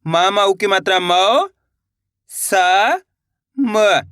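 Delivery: मौ, सा, म sounded out separately, each drawn out; one syllable at a time. Shown as a demonstration. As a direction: sound out मौ... सा... म slowly with pauses between. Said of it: "एक बार फिर से देखिए". (मौ, सा, म एक-एक अक्षर खींचकर अलग-अलग बोलें)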